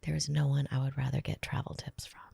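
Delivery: soft unvoiced whisper. Shown as a demonstration. Host whispering a compliment to her cohost. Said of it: "whisper a compliment back to me".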